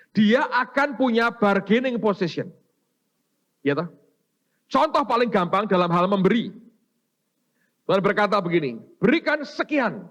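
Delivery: high, loud preacher's proclamation; preaching at full force. Acted. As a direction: drive it home to the congregation. Preacher raising voice at congregation.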